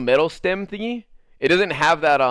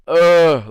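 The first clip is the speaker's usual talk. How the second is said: loud, heavy groan of frustration; frustrated groan; 3 semitones higher, 9 dB louder than usual. Real, not acted. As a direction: let out a big exasperated ugh. Frustrated at the long wait.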